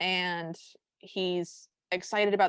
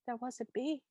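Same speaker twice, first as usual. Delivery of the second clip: much softer, small innocent little voice; mock innocent excuse; 3 semitones higher, 10 dB quieter than usual. Host jokingly quoting her own tantrum excuse, hushed and innocent. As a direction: play the sweet excuse, hushed.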